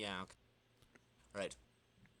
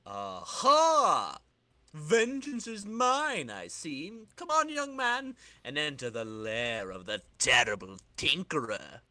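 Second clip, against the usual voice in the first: theatrical, slow villain gloat; villainous old tinkerer; 4 dB louder, slower than usual. Acted. Tinkerer welcoming his new client into his lair, gloating. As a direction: savor it like a comic-book villain.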